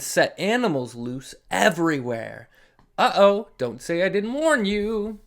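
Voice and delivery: lively, sing-song read-aloud; animated storybook voice with dramatic pauses; reading to children. Acted. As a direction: read it big for the little ones. Reads picture book to children.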